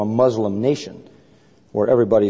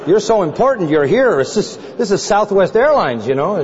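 amazed teasing; 6 semitones higher than usual. Retold or imitated. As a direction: play the stunned man ribbing him about flying coach. Man amazed, teasing about coach.

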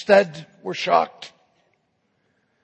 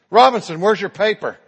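loud, raised roll-call demand; 4 semitones higher, 8 dB louder, faster than usual. Acted. Professor confronting Robinson over his paper, demanding. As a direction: call out the next culprit firmly. Professor calls the next student.

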